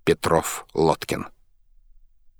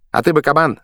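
high, loud shout toward the well; 9 semitones higher, 5 dB louder, faster than usual. A narrator's reading. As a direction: yell it across to the well. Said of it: "закричал он в сторону колодца".

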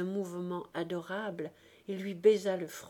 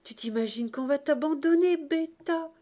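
high, loud teasing rebuke; animated, exclaimed mock reproach; God gently scolding; 6 semitones higher, 6 dB louder than usual. A narrator's reading. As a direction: scold a silly child for doubting you. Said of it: "Le bon Dieu dit".

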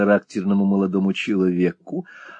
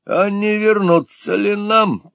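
slow, drawn-out and much higher; drawled question; 12 semitones higher, 3 dB louder, slower than usual. A narrator's reading. Малберри лениво дразнит приятеля, растягивая слова. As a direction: Sir Mulberry lazily teasing his friend, drawn out. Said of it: "протянул сэр Мальбери".

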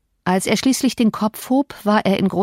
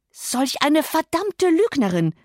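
angry cry; 9 semitones higher, slower than usual. Acted. Cousin calls Cathy a liar, angry cry. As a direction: exclaim slowly in high outrage. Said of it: "schrie Earnshaw".

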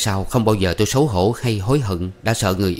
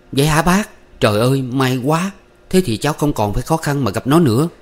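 higher-pitched happy relief; overjoyed, eager questioning; 5 semitones higher than usual. Acted. Young man hopes to meet his sweetheart, overjoyed. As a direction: let relief and joy lift the voice. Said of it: "Tôi mừng đến muốn ngợp thở và hỏi lia lịa".

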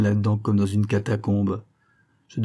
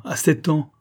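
higher, grief-stricken repeat; anguished echo; rising anguish; 7 semitones higher than usual. A narrator's reading. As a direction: lift the voice in pain on the age.

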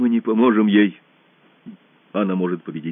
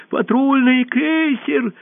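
high, loud, drawn-out cry; shout of alarm; 5 dB louder, much slower than usual. A narrator's reading. Someone in the hall warning everyone, shouting. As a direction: call out the warning high and long. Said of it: "крикнул кто-то в зале".

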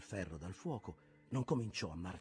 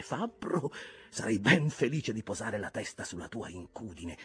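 louder, urgent captain; intense Ahab; 3 semitones higher, 7 dB louder than usual. Acted. Ahab begging the smith to smooth his scar, urgent and louder. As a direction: press him hard, voice rising.

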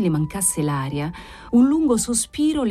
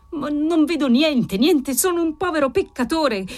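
high, anguished outcry; despairing, raised-pitch protest; desperate cry; 8 semitones higher than usual. A narrator's reading. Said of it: "gridò disperatamente il marchese Cavalcanti".

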